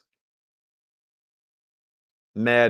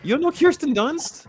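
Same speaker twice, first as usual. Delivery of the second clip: 1 shocked disbelief. Real, not acted.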